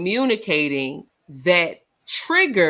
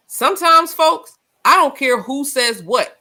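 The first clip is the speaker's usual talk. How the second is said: emphatic preaching; 7 semitones higher, 4 dB louder than usual. Real, not acted. Preacher-style emphatic point.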